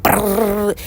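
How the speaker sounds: high rolled trilling sound; playful imitation of a fast flapping rattle; imitated dangle noise. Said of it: "and they be like".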